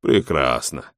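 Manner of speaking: low, soft sarcastic remark; dry sarcasm, pitched well down. A narrator's reading. Robin answers the lady's account of deadly customs, sarcastic. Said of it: "саркастически заметил Робин".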